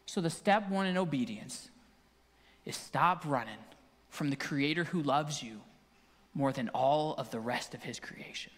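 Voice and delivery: low, soft, tender preaching; quiet, measured closing plea; hushed sermon appeal. Real, not acted. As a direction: bring the message down to an earnest near-whisper.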